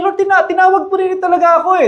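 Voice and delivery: put-on high, quick insistent child; a boy pleading he really was called; child's plea. Young Samuel insisting to Eli, high and hurried.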